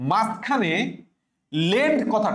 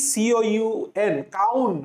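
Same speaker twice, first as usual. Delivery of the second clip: slow spelling; slower than usual. Shown as a demonstration. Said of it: "তাহলে councillor বানানটা কি?".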